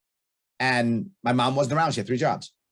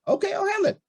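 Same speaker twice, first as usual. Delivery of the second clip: much higher, airy mock-cheerful okay; father breezily taking over homework, much higher; mock breezy agreement. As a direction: toss it off lightly and brightly. Retelling agreeing to handle it.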